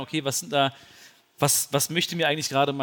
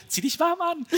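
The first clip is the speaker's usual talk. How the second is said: mom's advice; 13 semitones higher than usual. Retold or imitated. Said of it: "jeder Ratschlag meiner Mama".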